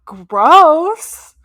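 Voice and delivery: loud, high burst of disgust. Real, not acted. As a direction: spit it out like it tastes awful. Disgust at bubblegum milkshake.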